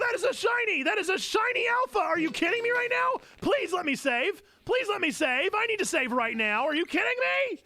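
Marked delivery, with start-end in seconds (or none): 0.0-3.2 high excited yelling; real excitement, pitch way up; thrilled shout. Real, not acted. Finds shiny alpha Pokemon. 3.4-6.6 high urgent begging; excited pleading. Real, not acted. Begging game to save.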